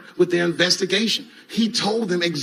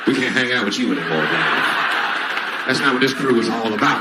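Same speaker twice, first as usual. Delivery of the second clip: disapproving buddies; 3 semitones lower than usual. Retold or imitated. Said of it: "they're like".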